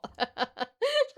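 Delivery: high laugh; laughing at own joke; real laugh. Real, not acted. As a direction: break into laughter. Laughing at herself.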